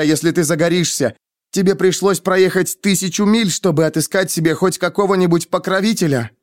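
high-pitched scornful rant; explosive tirade. A narrator's reading. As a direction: pour out humiliating reproaches without letting up. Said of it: "взорвалась Денна". (гневная тирада высоким голосом)

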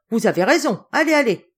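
loud, high, emphatic go-ahead; hearty dismissal. A narrator's reading. General sending his niece off to her sister, hearty and loud. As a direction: send them off with loud encouragement.

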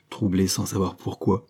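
ordinary delivery, nothing marked

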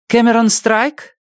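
high, slow call across a waiting room; calling out a name. Acted. Nurse calling name in hall.

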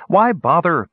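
high, quick retort; shot back. A narrator's reading. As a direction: snap the question back at him. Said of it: "Perion shot back".

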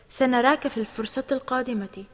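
plain reading voice

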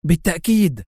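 eager confirmation; enthusiastic. A narrator's reading. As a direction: agree with ready enthusiasm. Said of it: "هتف الدكتور أحمد في حماس".

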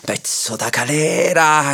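furious landowner roaring at the man in the jar; roaring insult. Acted. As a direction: spit out the insult with anger. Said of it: "riuggì allora lo zirafa".

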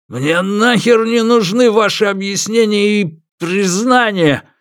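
high, slow, raging refusal; furious rejection. A narrator's reading. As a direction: spit it out furiously.

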